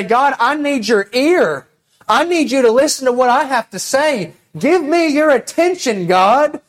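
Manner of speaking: calling on God with rising urgency; bold demand. Pretend. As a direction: demand God's ear boldly. Bold prayer to God acted out.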